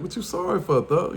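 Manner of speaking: high, feigned innocent question; playing dumb. Pretending not to know what his friend means, mock innocent high question. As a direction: act like you have no idea, pitched well up. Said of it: "I'm playing crazy".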